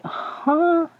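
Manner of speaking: sudden high squeak of disbelief; a high, shocked huh; mother caught totally off guard, high and incredulous; baffled huh. Retold or imitated. Mother baffled by son's call.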